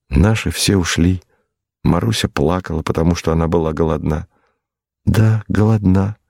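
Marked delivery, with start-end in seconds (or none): 5.1-6.2 put-on slow, mildly plaintive little girl's reply; plaintive simplicity. A narrator's reading. Hungry girl confirms she was hungry, plaintive and artless. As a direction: echo it slowly, with artless plaintiveness. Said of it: "с жалобным простодушием повторила девочка".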